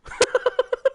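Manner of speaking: rapid high-pitched giggle; helpless high giggling; high laugh. Real, not acted. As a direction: burst into staccato high laughter. Laughs at landing in Finland.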